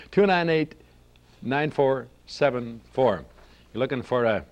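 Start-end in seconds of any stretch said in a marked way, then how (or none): none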